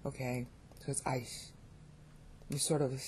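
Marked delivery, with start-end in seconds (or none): 1.1-1.5 "ice" with a soft, sh-tinged final S; softened S. Shown as a demonstration. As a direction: let the end of ice soften toward a Castilian S. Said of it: "really soft".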